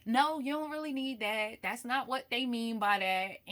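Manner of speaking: firm but loving correction; imitating grandmother. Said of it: "she'd be like".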